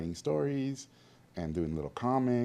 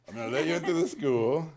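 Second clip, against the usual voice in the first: higher mock Hungarian teacher, louder; Hungarian accent; 9 semitones higher, 6 dB louder than usual. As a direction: imitate the stern Hungarian teacher granting entry. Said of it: "he had a thick accent".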